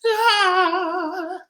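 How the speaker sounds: a high, held sung ah. Shown as a demonstration. Warming up the kids' voices, a high ah. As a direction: float up to your highest note. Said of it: "and our high voice".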